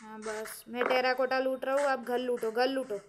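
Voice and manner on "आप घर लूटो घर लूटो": ordinary talk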